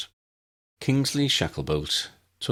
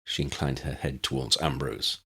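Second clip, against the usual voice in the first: ordinary delivery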